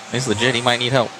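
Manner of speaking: ordinary talk, nothing marked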